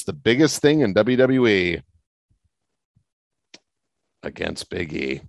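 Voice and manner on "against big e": flat, quiet and deadpan; flat delivery. Real, not acted. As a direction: announce it with zero excitement. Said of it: "you say that really flatly".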